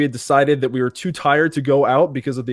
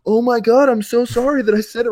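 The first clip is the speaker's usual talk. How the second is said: mock panicked apology; 11 semitones higher, 3 dB louder than usual.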